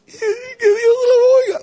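high, slow, wailing cry; crying woman's shout. Retold or imitated. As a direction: sob it out desperately. Weeping sister answers. (grito agudo y llorado de mujer)

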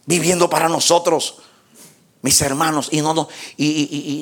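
normal talking voice

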